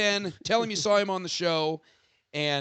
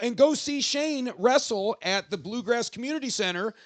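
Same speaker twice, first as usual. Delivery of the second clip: rising hype, high shout at end; wrestling promo; 6 semitones higher than usual. Acted. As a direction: build it up to a shout. Plugs Shane's wrestling match.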